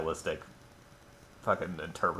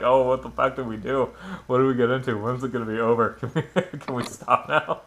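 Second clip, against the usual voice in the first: mock weary whining; 5 semitones higher, 6 dB louder than usual. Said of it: "They're like".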